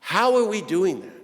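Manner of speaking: ordinary delivery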